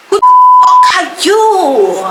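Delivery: the teacher shouting at her student, furious and loud; outraged shout. Retold or imitated. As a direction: scream it at her in disbelief.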